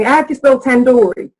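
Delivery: imitating an Indian English speaker; Indian accent. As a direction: quote the friend in an Indian accent. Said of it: "I had an Indian that asked me".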